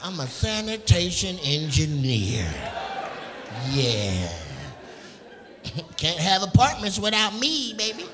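slowed, half-laughing mock of a smug trash man; mock garbage man. Comic imitating the sanitation engineer, slow and lightly smug. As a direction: savour each grand title, a little smug. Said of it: "He was like".

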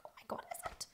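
hushed disbelieving whisper. Retold or imitated. Singer recalling her shock, hushed whisper. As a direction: breathe it out in awe. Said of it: "I was like".

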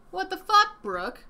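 high, incredulous outburst; appalled and exasperated; indignant exclamation. Real, not acted. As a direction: demand it in loud, high disbelief.

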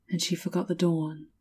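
plain reading voice

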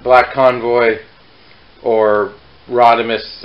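normal talking voice

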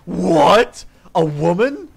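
loud, rising mock disbelief; hammed-up shocked yelps. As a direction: exclaim in over-the-top astonishment. Acts out shocked subreddit men.

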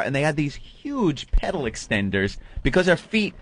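ordinary talk

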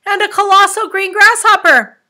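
very high, loud astonishment; crying out. A narrator's reading. Captain interrupting his officer with a sighting, crying out. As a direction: call out an unbelievable sighting.

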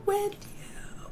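WITH YOU breathed in a soft whisper; whispered answer. Shown as a demonstration. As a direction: drop to a secret whisper for the answer. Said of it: "With who?".

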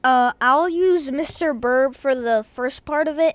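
ordinary talk, nothing marked

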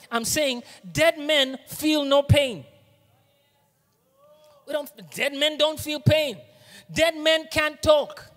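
fervent sermon climax, slowed down; preaching at full force. Acted. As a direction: pound each word from the pulpit. Preaching peak, loud slow.

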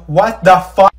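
loud stunned outburst; shocked shout. Acted. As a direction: blurt it out in shock.

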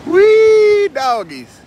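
high, loud, drawn-out whoop; excited holler. Real, not acted. Exclaims at the birds on camera.

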